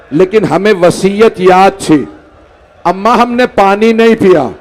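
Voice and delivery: chanted, grieving storyteller's cadence; mourning recitation. Acted. Preacher recites the martyrdom to the gathering, in a mourning chant. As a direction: recite the tragedy to move the mourners. Majlis narration continues.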